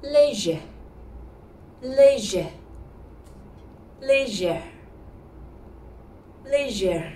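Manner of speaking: léger said lay-zher, falling; correct léger. Shown as a demonstration. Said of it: "put both together smoothly".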